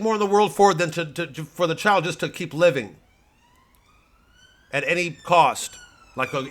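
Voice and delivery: loud, high, ranting right-wing pundit; blustering pundit. Acted. Satirical pundit ranting to his audience, blustering loud. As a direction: bluster like a cable news blowhard.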